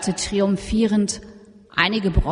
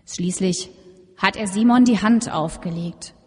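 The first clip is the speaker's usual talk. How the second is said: usual speaking voice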